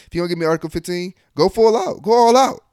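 loud, high, fast mock dare, almost yelling; daring shout. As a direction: goad him on at the top of your voice. Daring the commander to punish.

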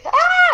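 high-pitched happy shriek; excited squeal. Real, not acted. Excited about Thanksgiving week.

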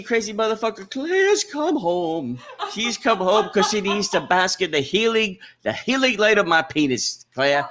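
high, loud Southern preacher; Southern accent. Pretend. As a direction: wail it like a mock Southern revivalist. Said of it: "It's a Southern accent".

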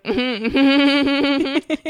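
strained high hums, trying to act normal; awkward mm-hmm. Retold or imitated. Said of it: "sitting there so awkward and be like".

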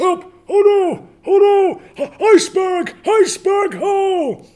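shrieking very high and loud in panic; panicked high screaming. Acted. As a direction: scream like a tiny sinking passenger. Tiny ship passengers panic.

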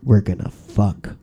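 slow, low mock rock-singer drawl; imitating a singer leaning into the mic, slowed right down; close-mic rock singer. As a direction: drag it out low and slow like a frontman teasing the crowd. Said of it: "gets really close to the mic and he goes".

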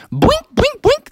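high bouncy boinks; boink sound effect. Acted. Bike hop sound effect.

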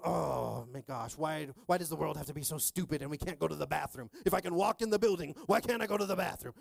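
hammed-up cranky complaining. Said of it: "I'm sitting at cranky".

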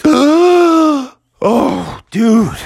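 high, gasping bear voice; dismayed bear. Acted. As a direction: gasp it out in dismay. Bear gasps in cave.

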